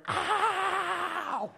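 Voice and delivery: long, very high held howl; mock yelp of pain. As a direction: imitate a spanked kid's drawn-out "ow". Retold cry of spanked brother.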